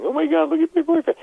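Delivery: put-on very high wailing girlfriend voice; high-pitched hysterical wail; crying girlfriend. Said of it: "his girlfriend's crying".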